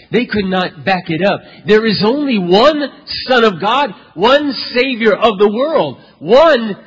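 slow, ringing sermon climax; full-force preaching. Acted. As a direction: drive the declaration home to the congregation.

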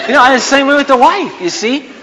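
normal talking voice

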